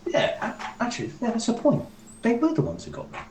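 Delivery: lightly voiced dad, pitched high; Brock. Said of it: "you hear Brock go".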